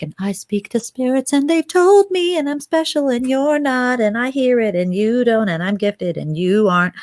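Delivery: high sing-song brag, swelling then easing; hammed-up "I'm so special" taunt; mock boasting. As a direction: parody a self-important know-it-all. Mocking a self-important boaster.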